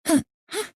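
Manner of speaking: normal talking voice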